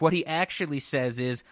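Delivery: ordinary delivery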